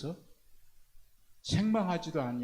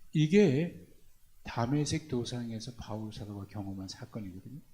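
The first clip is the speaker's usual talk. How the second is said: ordinary delivery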